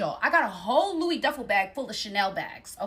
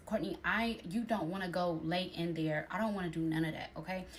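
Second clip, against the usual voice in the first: inner voice; 4 semitones lower, 6 dB quieter than usual. Retold or imitated. Voice in her head.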